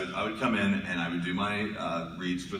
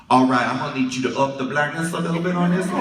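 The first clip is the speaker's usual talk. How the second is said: put-on high, loud old-lady director voice; little old lady; 7 semitones higher, 9 dB louder, faster than usual. Old lady client directing the voice actors, high and loud. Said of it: "this little old lady".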